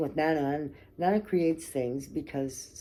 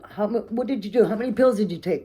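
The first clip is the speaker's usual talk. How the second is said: angry demand; 6 semitones higher, 5 dB louder, faster than usual. Retold or imitated. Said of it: "Now I'm mad, right?".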